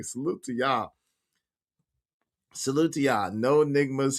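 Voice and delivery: normal talking voice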